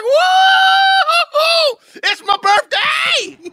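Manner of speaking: very high, slow, shrieking whoo-hoo; imagined ecstatic squeal. Pretend. Host imagines Paulette screaming with joy, high and slow. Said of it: "that you're like".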